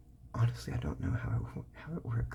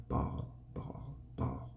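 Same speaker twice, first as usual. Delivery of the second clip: "ball" repeated quicker; a little faster; 7 semitones lower, 5 dB quieter, slower than usual. Shown as a demonstration. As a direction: speed up the word "ball". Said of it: "you do it a little faster".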